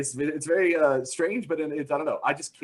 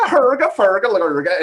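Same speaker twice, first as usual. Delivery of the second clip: laughing mock Swedish chef gibberish, higher and louder; 3 semitones higher, 7 dB louder than usual. Goofing off alone in the IKEA aisles, mock Swedish chef. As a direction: goof around like the Swedish chef. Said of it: "the Swedish chef voice".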